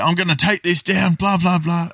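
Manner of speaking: high-pitched mock-upset protest; upset grumbling. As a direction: imitate someone upset, pitched well up. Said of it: "But then Blakey upset".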